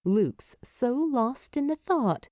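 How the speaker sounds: ordinary delivery, nothing marked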